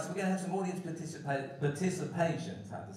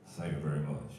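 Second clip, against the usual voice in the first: much lower crooning [name] thank-you; [name] impression; 14 semitones lower, 6 dB quieter than usual. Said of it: "to sound like [name]".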